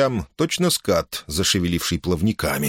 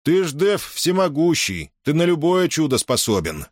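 higher-pitched, needling remark; sly taunting; 8 semitones higher than usual. A narrator's reading. Man goading the all-powerful monster, sly needling tone. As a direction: goad with mock flattery, pitched up. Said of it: "ехидно заметил Юрк".

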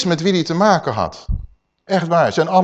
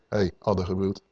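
"Hey, addergebroed" said low and soft, almost to himself; a quiet aside, no need to shout; quiet rebuke; 8 semitones lower, 9 dB quieter than usual. Shown as a demonstration. Yeshua quietly calling the Pharisee a brood of vipers, hushed. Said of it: "Dat hoeft hij niet op de straat te schreeuwen".

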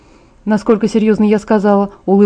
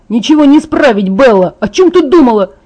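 louder and higher furious yell; angry shout; 4 semitones higher, 6 dB louder than usual. Acted. Wounded Jacob yells at Bella, furious. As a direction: rage at her, can't be fixed.

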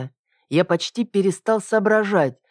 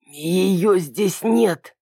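slow hoarse rasp; 3 semitones higher, much slower than usual. A narrator's reading. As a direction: croak it out slowly. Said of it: "прохрипел другой мужской голос".

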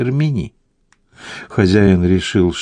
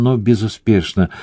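usual speaking voice

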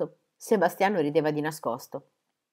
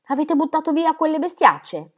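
high, tense governess's voice; anxious governess; 9 semitones higher, 4 dB louder than usual. A narrator's reading. Governess at the study door asking about kittens, high and anxious. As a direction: ask in a high, uneasy voice. Governess peeks in, still afraid.

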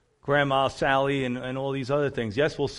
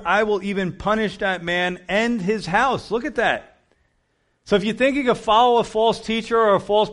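higher, louder warning from the pulpit; fiery preaching; 6 semitones higher, 5 dB louder than usual. Acted. Preacher warning followers of false prophets, fiery. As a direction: drive the warning home hard.

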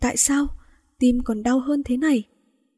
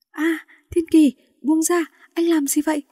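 frightened protest; 6 semitones higher than usual. Acted. Woman pinned, frightened, pushing him off.

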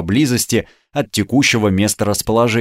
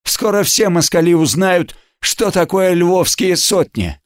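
stern promise; 7 semitones higher than usual. A narrator's reading. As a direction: promise it sternly to your own men. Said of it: "строго пообещал своим Олесь".

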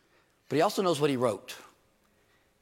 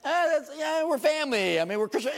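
much higher, breezy casual voice; lighthearted shrug. Retold or imitated. Preacher voicing easy forgiveness toward a church brother, high and breezy. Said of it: "'Cause God says".

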